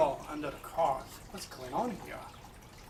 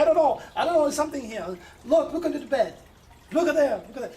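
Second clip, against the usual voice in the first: high, loud frightened pleading; retold frantic fear, higher and louder; panicked wife; 7 semitones higher, 6 dB louder, much faster than usual. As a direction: voice the terrified wife begging him to look. Wife terrified at night, retold.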